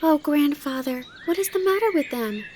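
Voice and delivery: put-on high child's dismayed exclamation; high-pitched startled concern; surprised shock. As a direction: exclaim like a shocked little girl. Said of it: "exclaimed Heidi in surprised shock".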